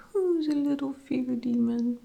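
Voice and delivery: high, loud doting coo, pushed even further up; baby talk. Retold or imitated. Xander keeps teasing the tiny demon, in high baby talk. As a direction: croon it like the demon is a puppy.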